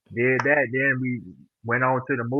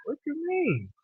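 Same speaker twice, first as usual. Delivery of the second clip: soft, high-pitched puzzled question; taken aback; 14 semitones higher, 7 dB quieter than usual. Retold or imitated. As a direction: act out being caught off guard, pitch jumping up. Retells reaction to hospital's worrying question.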